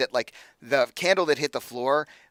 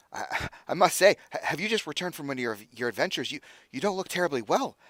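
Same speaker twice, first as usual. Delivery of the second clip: higher-pitched, flustered host; nervous stammering; 5 semitones higher, faster than usual. Acted. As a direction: trip over words while fussing over a guest. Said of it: "he's so nervous".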